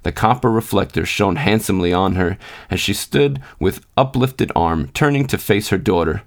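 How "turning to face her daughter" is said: ordinary delivery, nothing marked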